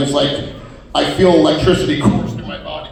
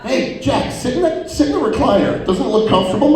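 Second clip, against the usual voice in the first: higher, sweet coaxing voice; sly coaxing friends; 8 semitones higher than usual. Retold or imitated. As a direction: coax him into the chair.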